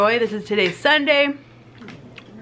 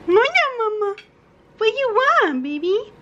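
high sweet voice; 11 semitones higher than usual. Pretend. Greeting mom in high sweet voice.

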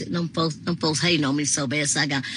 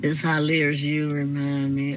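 ordinary talk

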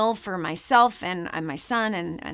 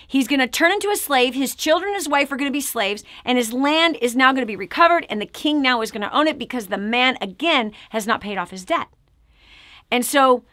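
ordinary talk, nothing marked

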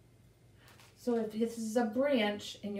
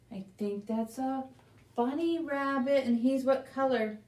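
ordinary delivery

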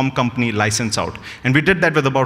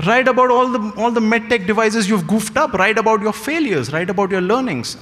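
higher, emphatic urging as the mentor; mentor's advice; 8 semitones higher than usual. Retold or imitated. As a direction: push the advice like an insistent senior doctor. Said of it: "He's like".

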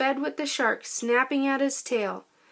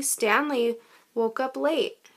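plain reading voice

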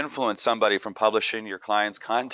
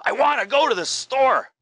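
much higher and louder, punching "go"; high excited tone; 5 dB louder than usual. Shown as a demonstration. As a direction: jump way up in pitch and hit "go". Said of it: "I can change that exact phrase just with my tone".

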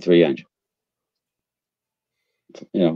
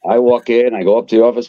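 ordinary delivery

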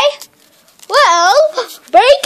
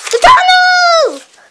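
higher, slow stretched yell of The tunnel; drawn-out shout; 9 semitones higher, slower than usual. Real, not acted. Kid stuck at the tunnel, slow drawn-out yell. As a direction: stretch the words out in alarm.

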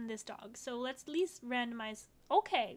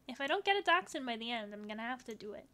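ordinary talk

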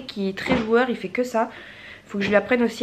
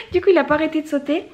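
laughing; 9 semitones higher, faster than usual. Real, not acted. Laughing about the jumping kitten.

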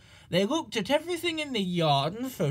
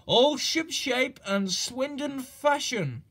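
proud little engine, drawn out; modest pride, lifted high and slow; 6 semitones higher than usual. A narrator's reading. Duck proudly telling City of Truro about Swindon ways, slow and high. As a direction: say it slowly with a small proud lift. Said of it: "said Duck modestly".

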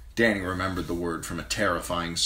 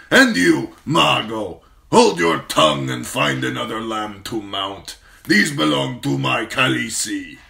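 very slow, loud, higher warlord boast; proud khal; 6 semitones higher, 9 dB louder than usual. Acted. Khal praises his fierce wife, slow loud boast. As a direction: savour her fierceness aloud. Drogo warns his riders.

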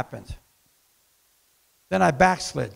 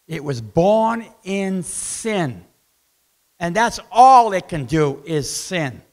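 ordinary delivery

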